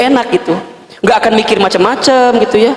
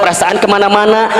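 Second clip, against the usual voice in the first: ordinary talk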